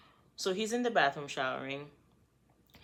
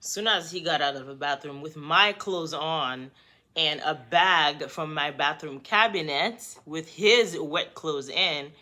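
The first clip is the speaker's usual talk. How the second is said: normal talking voice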